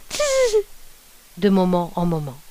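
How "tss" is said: very high piercing tss; shrill tss call. A narrator's reading. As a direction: call out for the lost dog with a sharp tss. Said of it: "et criant".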